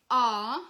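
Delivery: loud, held chest-voice note; loud vocal demo of chest voice. Shown as a demonstration. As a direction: push out a long, strong "ah". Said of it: "which is our chest voice sound".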